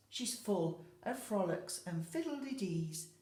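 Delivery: ordinary delivery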